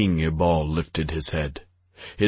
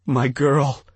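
high, louder sobbing; 8 semitones higher, 4 dB louder than usual. A narrator's reading. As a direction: sob the words in a high cry. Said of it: "the king sobbed".

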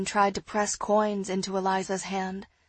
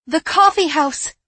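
loud, high burst of realisation; excited cry; 7 semitones higher, 13 dB louder than usual. A narrator's reading. Girl struck by a solution, crying out excitedly. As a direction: cry out the sudden idea. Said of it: "I cried".

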